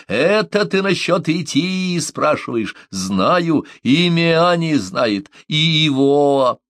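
slow, high, forceful delivery; rumbling outburst. A narrator's reading. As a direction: roll it out, stretching the key words. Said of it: "пророкотала она, словно водопад обрушился". (раскатисто, нараспев)

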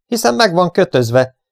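high-pitched disbelief; astonished, high-voiced objection; aghast. A narrator's reading. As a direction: protest it as if it can't be true. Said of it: "hüledezett Rogers".

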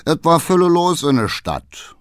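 Berlin dialect. A narrator's reading. Coachman reporting on the night in town, Berlin dialect. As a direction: report it in broad Berlin speech.

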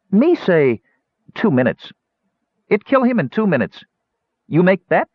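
high, simple gully dwarf voice. Acted. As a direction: offer the bet plainly, pleased.

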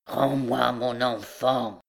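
slow, heavy, relentless demand; obsessed mother, slow and immovable; implacable recluse. A narrator's reading. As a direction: drag it out, unmoved by begging. Recluse repeats her implacable demand.

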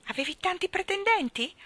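a hint of a curious young girl; curious friend. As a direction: ask shyly, leaning in. Anna asks curious question.

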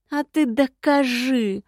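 slow, menacing dare; threatening. A narrator's reading. Wife daring her husband to prove it, slow and menacing. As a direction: lean in and threaten him. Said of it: "с угрозой в голосе проговорила я".

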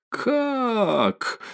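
high, drawn-out shocked cry; high-pitched indignant shock without extra volume; sudden outcry. A narrator's reading. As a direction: cry out in disbelief, pitch leaping up. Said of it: "вскрикнула вдруг, опомнившись".

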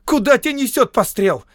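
high, loud outraged shout; shrieked rebuke; angry scolding. A narrator's reading. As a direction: yell at the clumsy rascal. Woman scolds man groping in dark.

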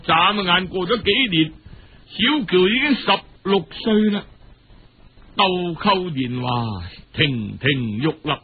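ordinary delivery, nothing marked

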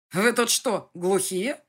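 loud, high furious yell; angry shout. A narrator's reading. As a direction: yell at them in anger. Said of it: "разозлился Дэвид".

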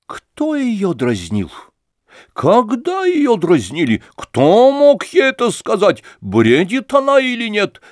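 громко и высоко, с гневом; гневно допросить всех; трепеща от гнева. A narrator's reading. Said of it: "Трепеща от гнева". (мать, трепеща от гнева)

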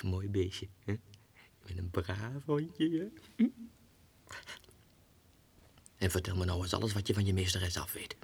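soft, low coaxing whisper; coaxing the dog Mitja in a low soft voice. Acted. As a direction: sweet-talk the little dog softly.

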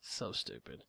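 a soft disgusted mutter. Real, not acted. Muttered aside repeated.

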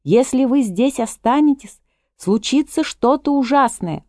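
Misty pleading the guests to flee; shouted warning. A narrator's reading. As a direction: warn the crowd from above. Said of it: "Мисти кричит".

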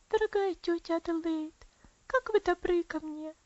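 put-on a sick child's voice, high and grateful; high childish voice full of love; loving little girl. A narrator's reading. Girl thanking her aunt at the bedside, high and loving. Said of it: "с любовью смотря в лицо своей тёти".

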